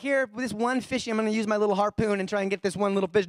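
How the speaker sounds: high, fast, mocking voice of a picky fisherman; fussy angler. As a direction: poke fun at a man hunting one little fish. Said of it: "You ain't going like".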